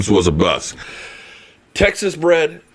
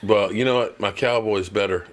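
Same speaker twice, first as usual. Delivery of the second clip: ordinary talk, nothing marked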